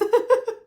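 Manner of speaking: sudden high, loud laugh; burst of laughter. Real, not acted. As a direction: crack up at herself. Laughs at her own silly voice.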